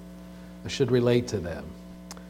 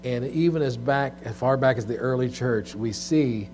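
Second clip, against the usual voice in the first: prayer; 2 semitones lower, 7 dB quieter than usual. Acted. Pastor leads congregational prayer.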